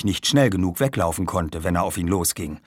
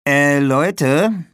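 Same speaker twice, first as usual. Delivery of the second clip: high, louder bid for attention; hesitant interruption; 6 semitones higher, 6 dB louder than usual. A narrator's reading. Jasper interrupts two bickering friends, uneasy interjection. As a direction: break in on a quarrel. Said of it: "unterbrach Jasper die beiden Zankhähne".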